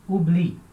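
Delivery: "Oublie" ending on a clear I; stop at the I. Shown as a demonstration. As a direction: end "Oublie" as ou-BLI, silent E. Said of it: "paro de falar aqui no I".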